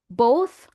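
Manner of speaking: "Both" as bow plus TH, lifted; final TH sound. Shown as a demonstration. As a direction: say "Both" with a clear TH at the end. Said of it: "bow na may T H sound sa dulo".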